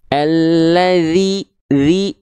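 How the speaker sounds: high, drawn-out alladzi with spread-lipped i; grinning "zi". Shown as a demonstration. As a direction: grimace through the i of alladzi. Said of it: "meringis-ringis seperti ini". (alladzi dengan vokal I sambil meringis)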